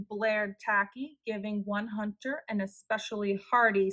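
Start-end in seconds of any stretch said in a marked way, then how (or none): none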